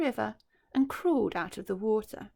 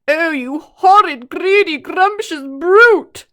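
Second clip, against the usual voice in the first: much higher, much louder, slow outraged cry; cried out; 8 semitones higher, 17 dB louder, slower than usual. Acted. Hippo crying out at the greedy croc, loud and outraged. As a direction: hurl the insults at him. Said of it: "cried Humpy Rumpy".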